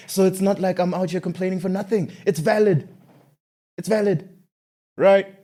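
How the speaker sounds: high-pitched, insistent self-defence; indignant protest. Real, not acted. Defends his angry complaining.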